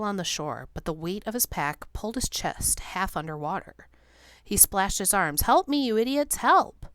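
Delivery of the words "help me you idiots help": high, loud desperate shout; panicked cry. A narrator's reading. Beloit half under water calling men, panicked. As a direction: scream for help.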